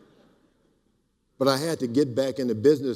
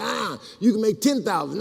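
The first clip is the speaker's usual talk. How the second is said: excited offer; 9 semitones higher than usual. Retold or imitated. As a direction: sell the bigger number with a shout. Retelling escalating money offers.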